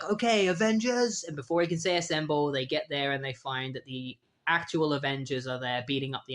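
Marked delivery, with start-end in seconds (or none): 0.0-1.2 high, heroic team-leader shout; rally the team like a cartoon hero; rallying hero call. Team leader calling his heroes to action, mock heroic. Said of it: "Immortal Man is like".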